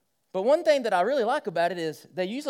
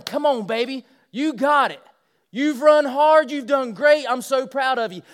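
higher, louder cheers, slowing on the praise; cheering daughter; 6 semitones higher, 9 dB louder, slower than usual. Pretend. Father at the finish line cheering his daughter, loud and proud. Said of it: "I'm gonna be there going".

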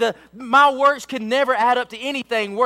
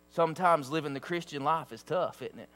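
low, hushed pleading to the congregation; soft earnest preaching; 8 semitones lower, 9 dB quieter than usual. Acted. Preacher gently warning his church, softly and low. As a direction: lean in and plead quietly with them. Quiet heartfelt stretch of sermon.

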